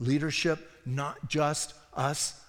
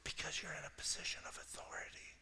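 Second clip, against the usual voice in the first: retold line, fully whispered; 17 dB quieter than usual. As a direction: breathe it out as a whisper. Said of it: "And Gaynell goes".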